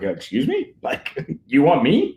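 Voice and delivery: mock disbelief with rising pitch; incredulous. Retelling his reaction to a job offer, incredulous. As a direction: reenact being taken aback, half laughing.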